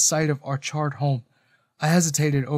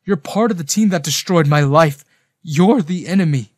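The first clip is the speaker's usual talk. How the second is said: louder, angry accusation; rage and betrayal; 3 semitones higher, 7 dB louder than usual. A narrator's reading. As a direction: accuse him, feeling betrayed. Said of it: "pounding with rage and betrayal".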